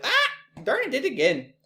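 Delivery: real high yelp at a slip; exasperated yelp. Real, not acted. Teacher who did it again mid-slide, exasperated. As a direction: jump in pitch, annoyed with yourself. Repeats the same button mistake.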